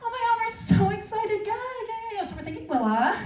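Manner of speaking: high, soft excited gushing; chatty sports fan. Acted. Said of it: "having a lovely conversation with his friend".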